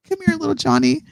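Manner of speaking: high, sugary praising parent. Retold or imitated. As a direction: gush over a child's drawing like a doting parent.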